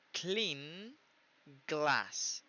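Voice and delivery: word by word, a clear gap between "Clean" and "glass"; separated words. Shown as a demonstration. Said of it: "nosotros tenemos que decir". ("Clean" y "glass" por separado, con pausa)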